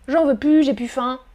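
"plus" said "pu", quick and relaxed; casual pu. Shown as a demonstration. Teacher shows fast familiar speech. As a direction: say "j'en veux pu, j'ai pu faim". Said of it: "Quand on parle rapidement".

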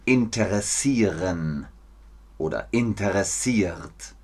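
"interessieren", "interessiert" with every syllable and R sounded; theatre German. Shown as a demonstration. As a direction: fully articulate "in-te-res-sie-ren", "in-te-res-siert". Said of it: "Man sagt nicht mehr". (Bühnendeutsch, „interessieren“ mit jedem R)

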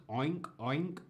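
nasal "oink, oink" like a pig. Shown as a demonstration. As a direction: grunt "oink, oink" as a piglet. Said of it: "El cerdo hace oink". (imitando al cerdo "oink, oink")

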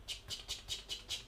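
whispered knife chop noises "tchic, tchic"; chopping sound. Shown as a demonstration. Teacher miming a knife cutting vegetables, whispered clicks. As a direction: click the tongue like a knife on a board.

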